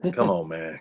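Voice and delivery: low, put-out grumble after a heavy sigh; dismissive attitude. Retold or imitated. Star reluctantly asked for a photo, dismissive. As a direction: sigh and brush them off, low. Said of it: "act like he had an attitude".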